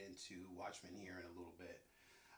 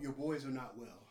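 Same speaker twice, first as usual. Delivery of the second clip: ordinary talk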